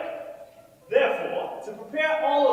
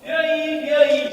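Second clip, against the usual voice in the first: town crier; 4 semitones higher, 8 dB louder than usual. Acted. Herald announces the leader's entrance.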